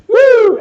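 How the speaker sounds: a loud, very high cheer; high excited woo. Real, not acted. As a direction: whoop it out to hype the topic. Cheer before the Agile slide.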